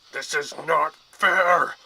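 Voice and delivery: slow, loud, high monster wail. A narrator's reading. Manticore protesting the hunters' attack, loud slow monster wail. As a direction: howl the complaint like a wounded beast. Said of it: "the monster wailed".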